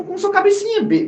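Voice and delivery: usual speaking voice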